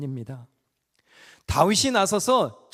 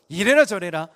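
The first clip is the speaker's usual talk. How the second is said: bossy order; 3 semitones higher, 7 dB louder than usual. Retold or imitated. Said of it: "하나님께 지시하는 교만함".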